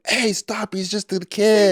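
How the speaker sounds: high, drawn-out imitation of intervening; pleading grandpa. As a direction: call out in a put-on protesting voice.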